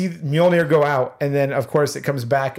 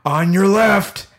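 slow, higher, louder line of a hero arriving; imitating Falcon; 6 semitones higher, 5 dB louder, much slower than usual. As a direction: deliver it like a dramatic entrance cue. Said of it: "And then you hear".

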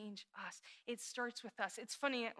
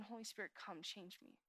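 soft, lowered prayer, almost to oneself; hushed prayer; 3 semitones lower, 12 dB quieter than usual. Acted. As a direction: pray it quietly and humbly. Quoted prayer inside sermon.

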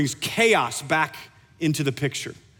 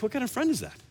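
incredulous; 7 semitones higher, 5 dB quieter, faster than usual. Retold or imitated. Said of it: "you'd be like".

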